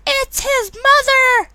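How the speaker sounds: very high, drawn-out mock cry; Lois. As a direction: burst in with a hammed-up falsetto wail. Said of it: "Lois just runs in just randomly and goes".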